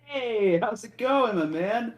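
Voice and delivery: high, loud, chummy hello; over-friendly prisoner calling out a cheery greeting; breezy greeting. Acted. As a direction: hail the prince like an old buddy. Prisoner breezily greets arriving orc prince.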